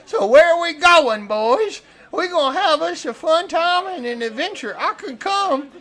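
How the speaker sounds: eager fish-camp hand. Acted. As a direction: invite yourself along, high and gleeful. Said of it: "Clevis follows you and he says".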